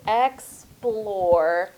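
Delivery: "explore" very slowly, ex then plore; syllable by syllable. Shown as a demonstration. Teacher hunting missing consonants, "explore" slowly broken up. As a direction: draw out both halves of "explore" separately. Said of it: "I'm missing my other consonants".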